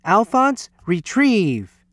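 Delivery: high, lifted call to a pet; called command. A narrator's reading. Lewis training Alphonse to fetch, high called command. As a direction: call the name up high, then give the order.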